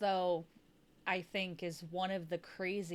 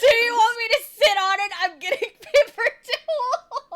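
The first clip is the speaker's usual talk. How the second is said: high, loud, fast yelling through laughter; laughing shout; 14 semitones higher, 15 dB louder than usual. Retold or imitated. Streamer re-enacting the retort to the coworker, laughing shout. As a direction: scream it while cracking up.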